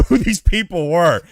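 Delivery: ordinary delivery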